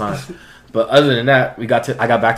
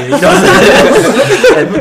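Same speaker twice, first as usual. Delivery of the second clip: much higher, louder, slow laughing; laughing hard; 12 dB louder, much slower than usual. Real, not acted. Telling friends about the drug test, laughing hard. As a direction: break into laughter on the words.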